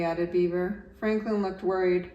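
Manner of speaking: ordinary delivery, nothing marked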